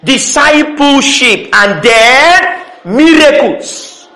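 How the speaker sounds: very slow, each word set apart; deliberate, drawn-out sermon list. Acted. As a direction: spell out the list slowly. Preacher lists slowly.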